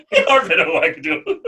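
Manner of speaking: laughing while repeating an absurd phrase; amused, giggly echo. Real, not acted.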